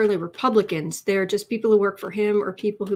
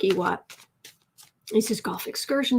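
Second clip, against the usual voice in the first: usual speaking voice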